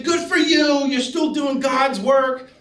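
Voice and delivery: a lightly voiced frail old woman, high and slow. A hint of dying Marge blessing his work, high and slow. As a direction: let a hint of the weak old nun come through, slowly.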